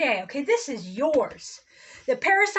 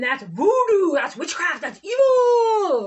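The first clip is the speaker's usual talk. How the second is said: mock outrage building to a loud, slow, higher "evil"; scornful imitation of churchgoers crying witchcraft; mocking accusers; 4 semitones higher, 5 dB louder, slower than usual. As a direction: parody the pious accusers, climbing as you go. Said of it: "then they wanna demonize you".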